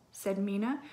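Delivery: plain reading voice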